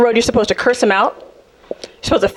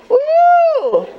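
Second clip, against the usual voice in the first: a sudden high woo; high yelp. Real, not acted. Comedian whooping high at her own joke.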